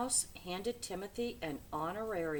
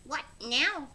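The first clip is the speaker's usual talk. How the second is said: desperate little voice; 6 semitones higher, 6 dB louder than usual. A narrator's reading. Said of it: "in a desperate little voice".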